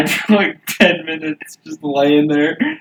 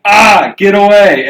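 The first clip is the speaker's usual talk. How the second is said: mildly yelped retold scream; louder, higher mock panic; 4 semitones higher, 7 dB louder than usual. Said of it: "screams, like".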